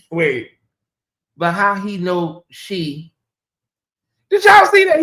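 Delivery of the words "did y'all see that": high, loud burst of disbelief; can't believe what she just saw; shocked disbelief. Real, not acted. Watching the clip with viewers, she cries out in shocked disbelief.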